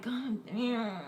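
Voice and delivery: retold whispered swearing; hushed dismay. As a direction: mutter it under your breath in disbelief. Retold reaction to the mess.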